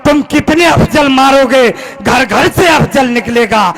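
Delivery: rhythmic, emphatic verse declamation; declaimed patriotic poetry; poem recitation. Acted. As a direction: hammer out the defiant couplet in a steady beat.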